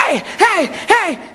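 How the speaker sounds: high, repeated hailing cries; calling out. Acted. Hitchhiker in the rain hailing a driver, calling out. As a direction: flag down a car with your voice. Third man hails car.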